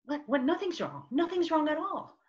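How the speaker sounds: retold flustered 'nothing's wrong', climbing up; mock surprised defence; taken aback denial. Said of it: "And I went like".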